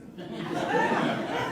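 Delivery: breathy, high burst of laughter; preacher cracking up at his own story. Real, not acted. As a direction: let a real laugh break out.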